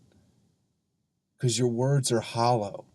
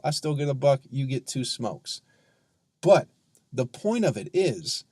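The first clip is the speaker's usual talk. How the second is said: normal talking voice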